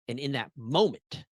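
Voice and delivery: ordinary delivery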